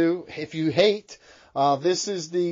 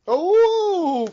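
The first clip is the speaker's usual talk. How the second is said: high, long-held ooh; mock amazed ooh; 12 semitones higher, 4 dB louder than usual. Host reacting to a twist, mock amazement. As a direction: hold a high, playful ooh.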